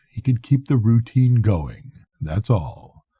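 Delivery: plain reading voice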